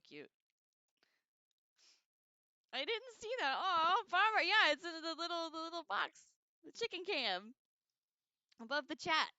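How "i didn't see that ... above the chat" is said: higher and louder chatter; bubbly excitement over the chicken cam; excited. Real, not acted. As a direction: eagerly point out the cam.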